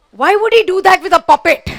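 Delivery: loud, high mock-indignant cry; mock outrage. Comedian scolding crowd over puppet fantasy, mock outrage. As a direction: exclaim in pretend disgust, pitch way up.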